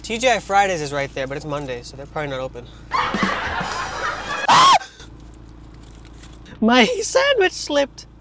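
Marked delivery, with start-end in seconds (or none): 2.7-6.5 high loud cackle; shrieking laugh. Real, not acted. Laughs at own joke. 6.6-8.1 high, slow, still laughing. Real, not acted. Still laughing after joke.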